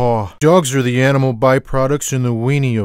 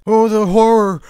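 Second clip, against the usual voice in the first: hammed-up gasp of horror, pitched higher; mock horror; 8 semitones higher than usual. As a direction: play the horror up for laughs.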